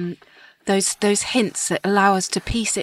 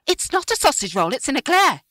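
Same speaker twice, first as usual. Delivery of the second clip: put-on higher, indignant old lady; indignant old woman; 8 semitones higher than usual. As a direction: protest like a cross old lady. Wyn voiced indignant.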